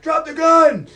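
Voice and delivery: loud, high-pitched mock cop yell; full-volume acted command; police shout. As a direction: shout it like cops closing in. Acting out cops confronting the gunman.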